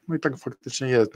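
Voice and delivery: ordinary talk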